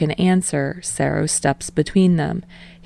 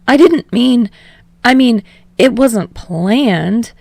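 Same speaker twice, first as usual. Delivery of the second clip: sputtering; 7 semitones higher, 7 dB louder than usual. A narrator's reading. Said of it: "Kirishima sputters".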